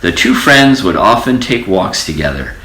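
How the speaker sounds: ordinary delivery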